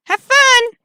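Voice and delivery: high, loud singsong call; perky send-off. Acted. Co-worker seeing off a delivery, loud high chirp. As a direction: call it out brightly after them.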